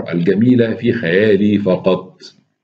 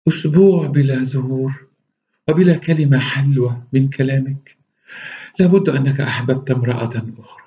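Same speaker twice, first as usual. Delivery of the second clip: surprised; 6 semitones higher than usual. Acted. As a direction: feign shock and jealousy. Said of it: "قالت في دهشة".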